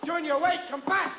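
high, urgent call; calling after someone. Acted. Father to son storming out, calling after him. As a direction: call him back as he runs off.

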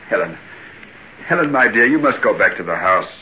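ordinary talk, nothing marked

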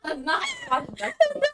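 very high mocking echo through laughter; squealed, laughing mimicry. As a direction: repeat it back, helpless with laughter. Echoes the quote while laughing.

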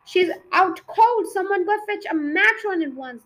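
high, loud call for help; alarmed teacher shouting orders; urgent call. A narrator's reading. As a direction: call the children to go at once.

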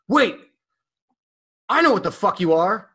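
put-on high, loud drunk yell; drunk friend. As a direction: squeal it like a drunk buddy. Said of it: "and he goes".

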